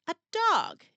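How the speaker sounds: excited squeal. Acted. Narrator imagining Jackson's mascot, squealing with excitement. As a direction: burst out with it like a thrilled kid.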